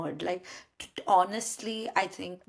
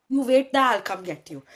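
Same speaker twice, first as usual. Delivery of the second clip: mock heroic confidence; 4 semitones higher, 9 dB louder than usual. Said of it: "see, this is my, my confidence".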